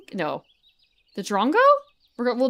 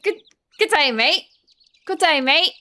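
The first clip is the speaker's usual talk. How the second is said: hammed-up Aussie greeting, pitched high; loud, playful mock Australian; Australian accent; 7 semitones higher, 6 dB louder than usual. Streamer trying out what Australians say, in a mock Australian accent. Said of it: "What do the Australians say?".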